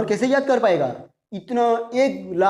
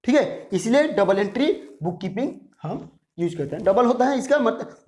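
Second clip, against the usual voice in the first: usual speaking voice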